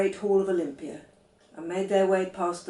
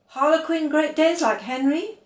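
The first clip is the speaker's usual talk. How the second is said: excited asking; 9 semitones higher, 7 dB louder than usual. A narrator's reading. Janie eager about Great Danes.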